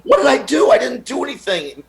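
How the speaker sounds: the comedian protesting innocence, high and baffled. Retold or imitated.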